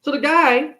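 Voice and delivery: ordinary delivery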